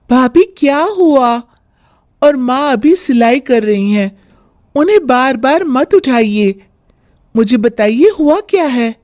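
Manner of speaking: put-on high, polite but firm girl; young sister-in-law voice. Younger sister-in-law to bhabhi, politely firm. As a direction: protect mom, ask what's wrong.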